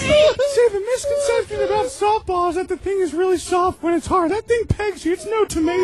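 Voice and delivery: shrill-pitched mock voice way up high; very high put-on voice. Friend jumps into the bit, very high put-on voice. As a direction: squeal it as a silly character.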